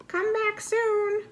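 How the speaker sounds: sing-song high voice of a friendly unicorn; high, slow unicorn farewell. Acted. Unicorns bid goodbye.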